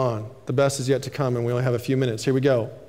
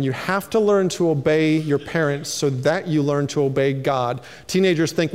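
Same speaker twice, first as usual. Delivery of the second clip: normal talking voice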